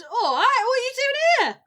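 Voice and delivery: high, loud chirpy Cockney geezer; bright London geezer hailing a pal; cheery Cockney greeting. Acted. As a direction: call out like an East End mate. NPC greets in Cockney bit.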